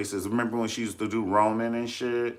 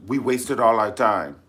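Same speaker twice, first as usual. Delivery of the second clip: loud, irritated quote; mock the angry prime minister; pissed; 4 semitones higher, 8 dB louder than usual. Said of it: "So he got pissed".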